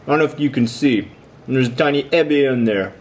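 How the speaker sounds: words round a bite of food; chewing while talking; mouth full. Real, not acted. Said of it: "take one more bite".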